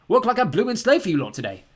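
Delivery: loud, bright cheery dad. A narrator's reading. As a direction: pitch it as a fun idea. Dad hamming complaint.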